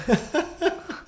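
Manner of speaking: sudden high-pitched laugh. Real, not acted. Laughing at a friend's cheeky remark.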